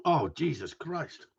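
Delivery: slow, stunned after a burning sip; shocked gasp. Real, not acted. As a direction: react to harsh liquor. After tasting strong schnapps.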